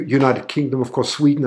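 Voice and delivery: ordinary talk, nothing marked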